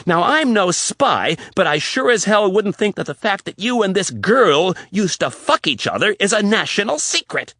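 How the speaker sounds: high, loud, building tirade. Acted. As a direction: let it build to a shout. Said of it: "Riley was getting louder".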